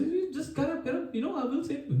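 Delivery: high, soft voiced mother; lightly voiced, reassuring the frightened king. Mother answers the pleading king.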